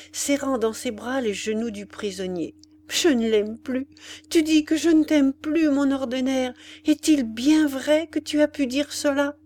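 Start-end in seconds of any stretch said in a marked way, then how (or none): 2.9-9.4 higher, louder hurt protest; wounded Ethel. A narrator's reading. Ethel to Ordener, stunned he doubts her love. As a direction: refuse to believe he said it.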